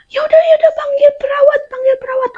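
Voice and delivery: high urgent voice; panicked sister. Retold or imitated. Sister telling someone to call the nurse, high urgent voice. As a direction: urge someone to fetch the nurse, high and fast. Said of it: "kakak aku bilang".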